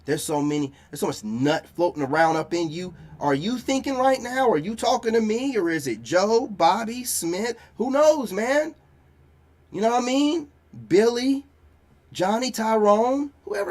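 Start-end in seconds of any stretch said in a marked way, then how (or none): 3.2-13.7 higher and louder, sneering through the names; loud mocking jab. Real, not acted. Mocking a woman over past partners, loud and high. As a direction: ramp up the ridicule, voice up and loud.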